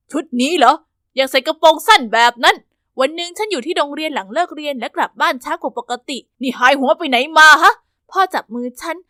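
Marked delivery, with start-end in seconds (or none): 0.1-2.6 louder scolding father; angry father. Acted. Controlling father scolding his daughter's short skirt, loud and angry. 6.6-7.7 loud angry demand; furious father. Acted. Father yelling at his daughter for coming home late, loud and furious.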